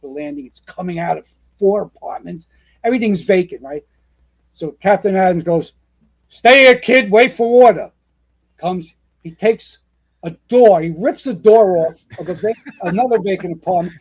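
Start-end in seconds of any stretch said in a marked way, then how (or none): 6.3-7.9 loud, firm captain's order; barked order. Retold or imitated. Captain orders the rookie to hold, loud command. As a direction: bark the order over the fire noise.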